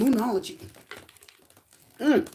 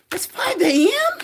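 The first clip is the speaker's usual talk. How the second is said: surprised; 11 semitones higher, 4 dB louder, slower than usual. Real, not acted. Reacting to a viewer's message.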